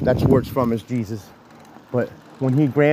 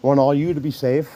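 ordinary talk, nothing marked

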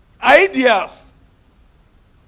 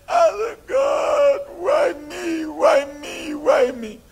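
frustrated cry; 14 semitones higher, 11 dB louder, much slower than usual. Retold or imitated. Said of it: "when he became so frustrated".

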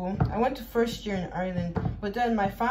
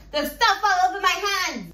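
higher, louder repeat of the complaint; mock outburst; 9 semitones higher, 5 dB louder than usual. Vlogger wails about messy hands, mock outburst. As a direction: cry out in mock dismay.

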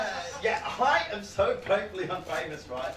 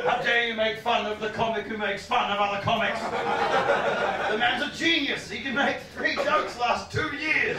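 mock outrage; 5 semitones higher, 5 dB louder than usual. Fan defends a genius to comic, mock indignant. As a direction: defend your idol with mounting offence. Acts Stewart Lee's outraged fans.

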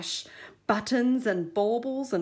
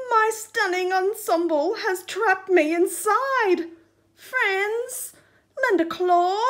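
high, pleading call to friends; calling out in dismay; 9 semitones higher, 6 dB louder than usual. Acted. Trapped crab calling his fleeing friends, dismayed plea. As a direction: beg the others to come back. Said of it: "he called out in dismay".

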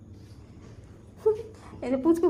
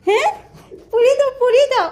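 loud, high, laughing outburst; excited; 11 semitones higher, 8 dB louder than usual. Real, not acted. Parent revealing the surprise to the child, loud and excited. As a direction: exclaim it high and full-voiced.

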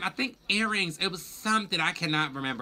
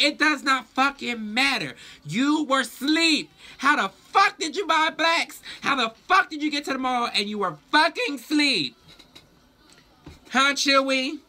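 furious yelling; 8 semitones higher than usual. Retold or imitated. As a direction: yell like you caught him lying. Catching boyfriend's lie, yelling.

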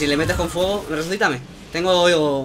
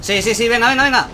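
excited urging; 7 semitones higher than usual. Real, not acted. Urging revive in game.

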